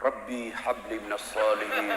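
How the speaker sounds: slow, low prayer murmured into the ear; whisper in ear. Retold or imitated. Uncle whispering to the boy on the football field, slow whisper. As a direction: lean in and murmur it slowly. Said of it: "Saya bisik telinganya".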